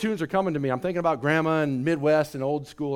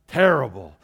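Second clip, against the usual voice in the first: "Terrible" dropped far lower and dark; ominous low; 5 semitones lower, 4 dB louder than usual. Shown as a demonstration. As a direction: let "Terrible" fall low and heavy.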